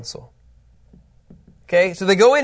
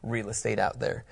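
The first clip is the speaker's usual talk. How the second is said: dismissive grumble; 6 semitones lower, 10 dB quieter than usual. Retold or imitated. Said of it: "they're like".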